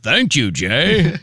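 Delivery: slow, drawn-out phony thanks; exaggerated, put-on gratitude, dragged out; mocking fake-voice imitation. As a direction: mimic a fake thank-you, stretching each word. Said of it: "He was doing an impression".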